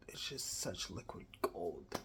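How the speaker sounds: breathless whisper of awe; hushed, amazed whisper; awed whisper. Real, not acted. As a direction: whisper it like you can't believe it. Awed whisper over makeup.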